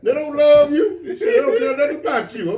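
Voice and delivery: high, loud, laughing through it; wound-up preacher rant, half laughing; mock rant. Old teacher ranting about women, high loud and laughing.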